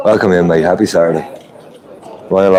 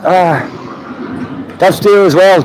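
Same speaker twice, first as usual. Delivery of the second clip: surprised at the price; 10 semitones higher, slower than usual. Real, not acted. Streamer to viewers about French diesel, shocked high exclamation. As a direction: gasp at how dear it is.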